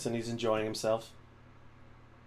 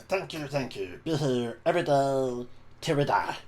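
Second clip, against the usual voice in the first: a slightly raised, lightly voiced goblin bard; 3 semitones higher than usual. Goblin bard thanking his cheering fans, light goblin voice. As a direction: thank the crowd as a cocky little goblin. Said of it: "It's a goblin voice".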